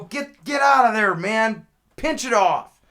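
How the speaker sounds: high, full-volume yell; angry chasing-off shout. Retold or imitated. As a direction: holler to run a trespasser off. Yelling at man behind the bush.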